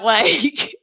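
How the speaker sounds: usual speaking voice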